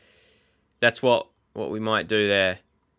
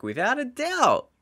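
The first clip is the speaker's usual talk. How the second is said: much higher, sung-out without a doubt; high emphatic; 12 semitones higher than usual. Real, not acted. Insisting the strike was perfect, high emphatic. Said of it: "Without a doubt in my mind".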